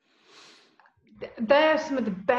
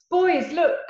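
put-on high, louder call to her boys; excited call; 9 semitones higher, 6 dB louder than usual. Calling to her absent sons.

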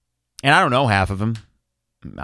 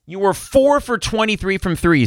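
higher, stern mock scolding; a fed-up coach's scolding, pitched up; imitating TJ's rebuke; 7 semitones higher than usual. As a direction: quote a fed-up coach chewing out his team. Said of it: "says T J".

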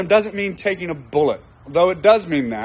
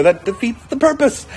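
higher-pitched mock protest; exasperated husband; 7 semitones higher than usual. Husband objecting to wife coming along, mock exasperation. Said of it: "And you go".

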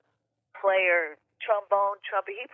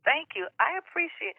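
excited; 8 semitones higher than usual. Real, not acted. Gushing thanks to the host.

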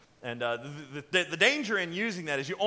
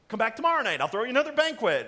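blurted invitation; 6 semitones higher, 7 dB louder, faster than usual. Acted. Acts Esther blurting an invitation.

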